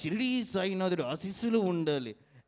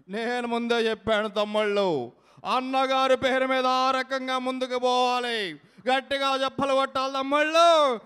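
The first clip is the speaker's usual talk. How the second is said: raised, loud rally exhortation; politician impression; 5 semitones higher, 5 dB louder than usual. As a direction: urge the brothers on, building louder. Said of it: "unique mimicry concept". (తమ్ముళ్లను ఉత్సాహపరిచే నాయకుడి బిగ్గర ప్రసంగం)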